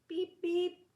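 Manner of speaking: high playful horn beeps; chirpy high beeps; grinning beep. A narrator's reading. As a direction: beep with a grin. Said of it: "he said, with a little blue grin".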